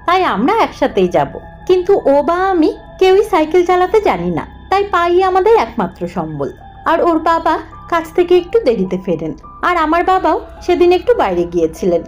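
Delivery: ordinary delivery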